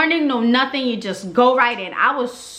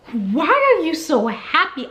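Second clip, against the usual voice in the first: higher, slow and put-out; irritated retort; 8 semitones higher than usual. Retold or imitated. Mother annoyed at the cheerful doctor, irritated and high. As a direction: needle her for sounding cheerful. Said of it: "I was so irritated".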